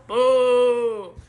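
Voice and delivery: loud, drawn-out mock boo; disappointed boo. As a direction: boo them like a let-down kid. Kid's reaction to being refused.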